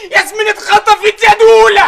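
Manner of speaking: finally grasp it and blurt it out; belated alarm. Acted. Friend suddenly realising the daughter was kidnapped, alarmed.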